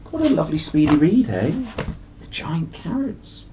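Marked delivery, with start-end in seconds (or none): none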